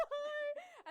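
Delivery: high squealing laugh into a breathless hi; laughing, starstruck hello; giddy laughing hi. Retold or imitated. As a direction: gush like a starstruck fan meeting her idols. Excited fan greeting idol.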